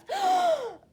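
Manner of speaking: loud high rushing breath of fire; fiery whoosh. Acted. Dragon blowing a huge whoosh of fire.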